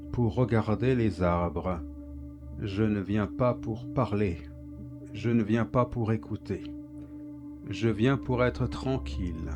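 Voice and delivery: ordinary delivery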